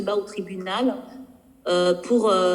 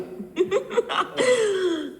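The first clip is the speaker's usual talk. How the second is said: a bright, high burst of laughter; delighted high laugh; 11 semitones higher than usual. Real, not acted. Laughing at herself while telling the interviewers. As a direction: laugh with pride at her own joke. Laughs after proud quip.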